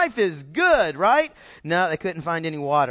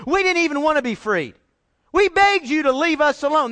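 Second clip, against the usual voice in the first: mock protest; 9 semitones higher, 5 dB louder than usual. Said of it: "They're acting now like they're out there been forced".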